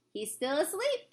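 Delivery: plain reading voice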